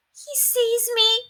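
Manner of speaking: high, slow, hushed wonder; a child's amazed little gasp of words; in awe. A narrator's reading. Ramona to herself, watching the TV, high and awed. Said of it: "she said in awe".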